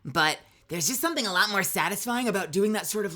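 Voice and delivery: normal talking voice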